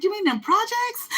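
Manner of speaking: lightly squeaky surprised question; incredulous. Retold or imitated. Asking the store man about the Bracket, mock surprise. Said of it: "I said".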